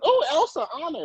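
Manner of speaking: high, gushing mock fan of Elsa. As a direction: gush over the princesses in mock delight. Mocking Disney-loving preschool teachers.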